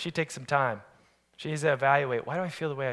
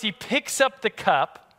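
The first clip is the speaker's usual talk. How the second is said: ordinary delivery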